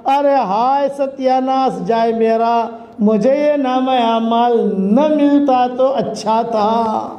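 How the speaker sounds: drawn-out acted wail of regret; imitated cry of despair, no real scream; mock crying out. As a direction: lament it slowly, stretching the final word. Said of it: "زور سے اسے چیخ کر کہے گی".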